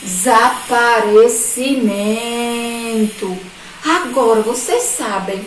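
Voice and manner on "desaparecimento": "desaparecimento" slowly stretched syllable by syllable without breaks; slow, sustained "desaparecimento" for young pupils; drawn-out word. Shown as a demonstration. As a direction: draw out every syllable of "desaparecimento".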